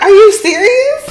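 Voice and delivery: very high, loud mock disbelief; surprised disbelief. Girl asking if she's serious, high and incredulous. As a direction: squeak it out in mock shock. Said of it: "She was like".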